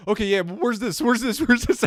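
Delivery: high, insistent mock demands; pestering fans. Host imitating impatient subscribers, high insistent pestering. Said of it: "it's just always like".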